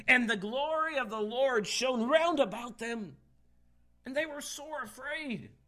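high, proclaimed Bible verse; dramatic scripture reading. Real, not acted. Preacher reading the angel's appearing to church, dramatic. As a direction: declaim the verse to the whole church.

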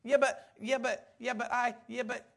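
rapid-fire "Yeah, but" squashed into "Yibbit"; "Yeah, but" fired off repeatedly as a quick "Yibbit"; real fast. Retold or imitated. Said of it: "he says it real, real fast".

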